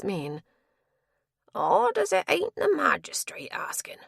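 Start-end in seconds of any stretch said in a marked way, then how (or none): 1.5-4.0 put-on old villager voice. Old villager peering curiously.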